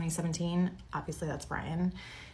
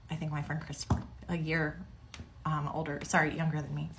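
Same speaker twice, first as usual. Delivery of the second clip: usual speaking voice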